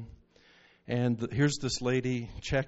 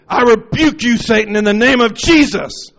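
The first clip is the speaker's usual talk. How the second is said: booming rebuke, much higher and louder; retold rebuke shout; 9 semitones higher, 14 dB louder than usual. Preacher rebuking Satan over the new believer, booming shout. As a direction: command the devil out at full volume. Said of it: "put his hand on my head and said".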